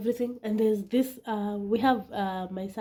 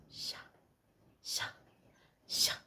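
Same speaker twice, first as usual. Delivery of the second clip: soft rhythmic slicing noise; soft sha-sha cutting sound; imitated slicing; 10 dB quieter than usual. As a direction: mimic a blade cutting meat. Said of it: "That's what I'm hearing".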